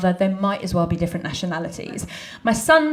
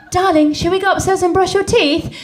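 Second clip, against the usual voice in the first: sweet mum; 8 semitones higher, 5 dB louder than usual. Retold or imitated. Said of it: "I'll say to my little girl".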